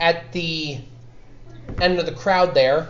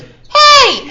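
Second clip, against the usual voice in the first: very high, loud yelled HEY; piercing wake-up call; fairy's shout; 8 dB louder than usual. Acted. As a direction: yell it to wake a sleeper. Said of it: "she goes".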